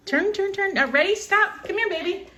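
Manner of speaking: high, coaxing grandma voice to a little one; sing-song encouragement, pitched well up. Real, not acted. Grandma coaxing her granddaughter to spin, high baby talk. Directing toddler's twirl.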